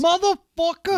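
high, loud shouted expletive; Samuel Jackson catchphrase. Acted. Asking if Samuel Jackson says it, loud mock shout. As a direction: shout it out as a hammed-up movie line.

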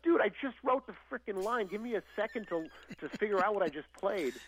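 annoyed musician defending himself; exasperated. Retold or imitated. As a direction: push back, fed up. Exasperated reply to criticism.

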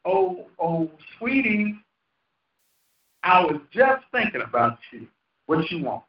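a sweet-talking flatterer, softened and low; mock sweet-talk. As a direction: coo it like you're buttering someone up. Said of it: "Whenever somebody wants something, they say".

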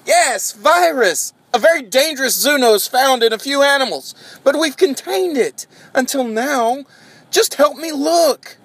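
high, urgent scientist voice; frantic scientist. Acted. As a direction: rush the warning, pitched high. Scientist explains the virus urgently.

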